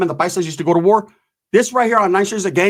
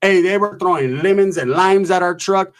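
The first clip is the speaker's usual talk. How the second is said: plain reading voice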